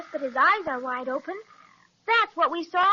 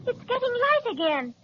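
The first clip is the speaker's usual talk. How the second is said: excited; 7 semitones higher than usual. Acted. Child sees light return.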